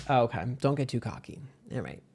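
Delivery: ordinary talk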